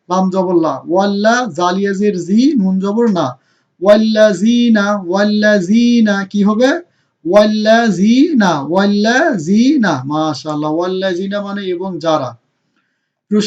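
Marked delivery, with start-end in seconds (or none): 3.8-6.1 slow ওয়াল্লাজিনা with a long held "zee"; madd lengthening. Shown as a demonstration. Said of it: "ইয়া মাদ". 7.2-9.9 very slow ওয়াল্লাজিনা, "zee" held long; madd lengthening. Shown as a demonstration. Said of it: "ইয়া মাদ".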